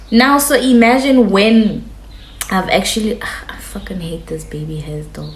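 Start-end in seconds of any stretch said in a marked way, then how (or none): 3.2-5.3 unvoiced, muttered aside; annoyed whisper. Real, not acted. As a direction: grumble it under your breath. Aside about her hair.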